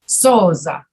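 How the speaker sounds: Souza with a buzzing Z in the middle; voiced Z. Shown as a demonstration. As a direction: model Souza, voice on through the Z. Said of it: "same thing you need to do with the word booze".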